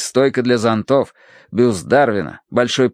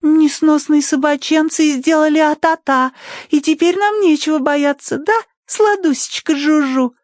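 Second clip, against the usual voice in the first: very high, sugary pet voice; cooing baby talk. A narrator's reading. Girl comforting her pet Жужу, cooing. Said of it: "проворковала Тосси".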